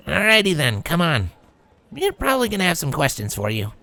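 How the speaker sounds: lightly voiced casual filly; a hint of a brash young mare; tomboy filly voice. A narrator's reading. Rainbow leads Sunburst.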